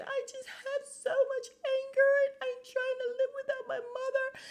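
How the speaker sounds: very high falsetto with a fake sob; mock crying Rinna. Mocking Rinna's soap opera acting, high fake weepy voice.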